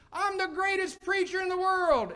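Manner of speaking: loud, high-pitched holler; boastful canyon yell. Retold or imitated. Preacher calling into Echo Canyon, full-voiced boastful yell. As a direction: bellow a boast out across a canyon. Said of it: "he'd try one more time".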